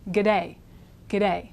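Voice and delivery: quick Australian G'day, g'day twice; fast Australian greeting g'day. Shown as a demonstration. Teacher drilling Australian g'day quickly. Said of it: "like an Australian a couple times fast".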